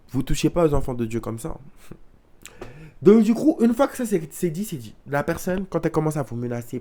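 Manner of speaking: ordinary talk, nothing marked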